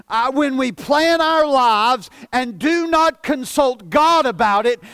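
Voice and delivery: ordinary delivery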